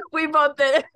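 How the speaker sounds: words breaking up with laughter; laughing. Real, not acted. Both derailed while sitting in the kitchen story, real laughter. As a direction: talk through real laughter, high and loud.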